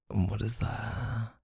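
hushed, slow teasing question to the partner. Acted. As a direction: lean in and ask it softly, stretching it out. Teasing guess during blindfold game.

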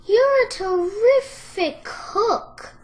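high, loud, slow piglet voice; high-pitched, lilting compliment; flattering piglet. A narrator's reading. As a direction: praise in a high, drawn-out voice. Piglet praises the fox again.

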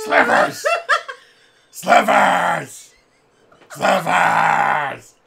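loud booming bear call; bear shout. Acted. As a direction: bellow the name like a bear. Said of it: "making [name] ruin his voice".

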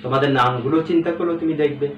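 ordinary talk, nothing marked

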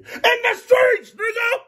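very high, slow drawn-out yell; high hyped street shout. Real, not acted. Shouts to camera.

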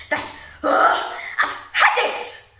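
loud exasperated groan into an outburst; fed up. Acted. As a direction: burst out as if at the end of your patience. Out of insults, fed up.

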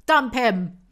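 shouted dump him; loud urging. Pretend. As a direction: yell advice at the book. Shouting at character.

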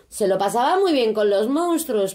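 usual speaking voice

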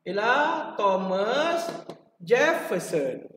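slow, drawing out Thomas Jefferson; slow reveal. Shown as a demonstration. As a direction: reveal the answer deliberately. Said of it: "ulangkaji jawapan mnemonik". (perlahan, Thomas Jefferson dipanjangkan)

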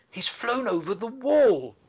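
high, loud excited call, slow; excited girl calling out; cried out. A narrator's reading. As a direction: shout after the flying robin. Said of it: "Mary cried out".

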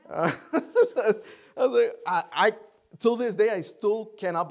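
high, loud, laughing voice; laughing disbelief. Real, not acted. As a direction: talk through real amazed laughter. Laughing in disbelief at correct answer.

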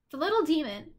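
usual speaking voice

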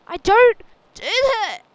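very loud, high-pitched playful protest; mock-outraged yell. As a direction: yell it in exaggerated indignation.

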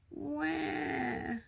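put-on high, drawn-out baby "wah"; sustained high-pitched mock baby cry; baby wail. A narrator's reading. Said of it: "cried baby ghost".

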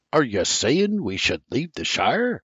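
ordinary delivery

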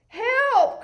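panicked cry. Acted. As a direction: yelp as you flee. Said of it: "cried the cats".

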